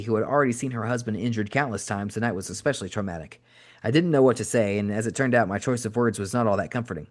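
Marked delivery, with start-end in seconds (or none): none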